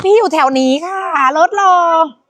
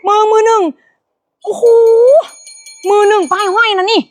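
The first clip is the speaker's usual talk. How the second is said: much higher, thrilled selling patter; excited selling; 8 semitones higher than usual. Real, not acted. Host hypes the brand-new jeans to buyers, excited and high. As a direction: sell it with delight.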